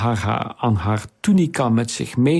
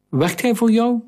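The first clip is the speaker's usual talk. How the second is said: put-on high, curious girl's voice; a much higher heroine probing; young girl's question; 11 semitones higher than usual. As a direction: ask eagerly like a young girl. Girl asks in dialogue.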